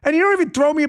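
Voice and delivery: high-pitched exasperated wife voice; imitating angry wife. Host acts out his wife scolding him, high and loud. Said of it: "she's like".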